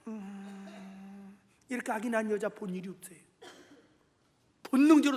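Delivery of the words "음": long, very soft low "mmm"; barely audible drawn-out hum, held in; quiet stifled hum. Acted. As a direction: mimic quietly holding in a groan. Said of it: "난 정말 조용히".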